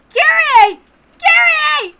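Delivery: screaming her name at the top of his lungs; high, desperate screams; frantic yelling. Acted. Battler screams for Kyrie after the phone drops, frantic.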